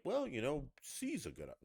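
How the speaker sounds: mock evasive waffle, starting high; evasive politician. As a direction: imitate a politician dodging the question. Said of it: "when they're like".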